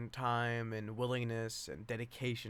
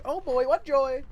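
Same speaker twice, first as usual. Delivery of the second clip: much higher, louder mock joy; sarcastic cheer; 13 semitones higher, 12 dB louder than usual. Real, not acted. Facing more script, sarcastic high mock joy. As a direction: fake delight.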